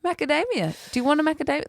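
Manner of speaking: high-pitched, as if nothing's happened; mum acting innocent. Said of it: "Like, nothing's happened".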